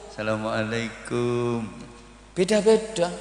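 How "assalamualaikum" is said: soft, slow, deferential greeting; humble student greeting. Acted. Santri bowing to his kiai, soft and deferential. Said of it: "Kalau santri ketemu kiainya, bungkuk-bungkuk".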